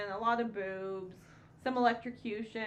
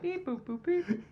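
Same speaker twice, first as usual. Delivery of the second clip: toy robot voice; 7 semitones higher than usual. Acted. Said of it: "we do voices".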